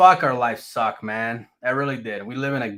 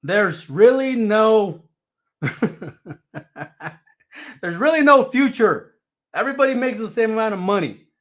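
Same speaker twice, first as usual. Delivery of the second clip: complaining; 6 semitones higher, 5 dB louder than usual. Retold or imitated. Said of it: "I'm like".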